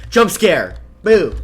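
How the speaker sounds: loud mock jump scare shout; startling boo. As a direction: yell it to spook the viewer. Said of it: "Did I scare you?".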